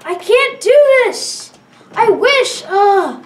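loud, high, drawn-out frustration; frustrated outburst. Real, not acted. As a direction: cry out in exasperation. Frustrated player losing in game.

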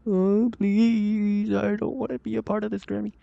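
high, soft whine of dread; whiny, frightened begging; scared pleading. Real, not acted. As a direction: plead in a small, high whimper. Pleading not to be jump scared.

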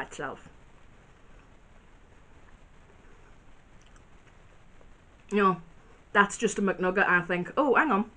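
chewing while talking; talking between bites; mouth full of food. Real, not acted. Seen 1: Bit nugget at -7, chews, talks with food still in mouth.

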